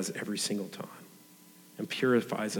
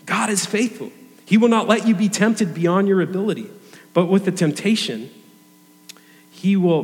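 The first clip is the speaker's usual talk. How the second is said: raised, ringing scripture delivery; emphatic preaching; 5 semitones higher, 7 dB louder than usual. Acted. Preacher assuring Christians from scripture, emphatic. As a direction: proclaim the verse with force and higher pitch.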